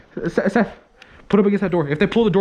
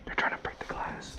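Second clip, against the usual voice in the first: tense hushed whisper; 18 dB quieter than usual. Real, not acted. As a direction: breathe it out, barely voiced. Hiding, enemies at glass.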